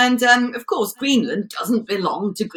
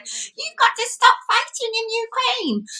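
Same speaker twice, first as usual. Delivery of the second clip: squeaky, drawn-out mock-French demand; much higher, slow pipsqueak protest in a French accent; Emmanuel Macron; 14 semitones higher than usual. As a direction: caricature a little man making a big demand. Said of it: "smacked his little hand on the table and said".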